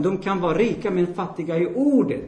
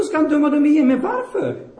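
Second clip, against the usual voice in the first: higher, louder, breaking into a laugh; incredulous laugh; 8 semitones higher, 4 dB louder than usual. Real, not acted. Preacher mocking the double-judgment belief, laughing disbelief. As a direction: laugh at how absurd it is.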